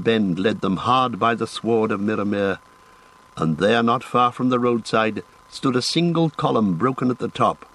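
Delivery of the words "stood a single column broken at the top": ordinary delivery, nothing marked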